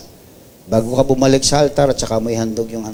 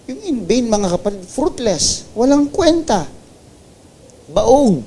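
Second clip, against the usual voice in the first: preaching at full force; 8 semitones higher, slower than usual. Acted. Pastor rebuking empty worship to the congregation, full-force preaching. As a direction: pound every word home from the pulpit.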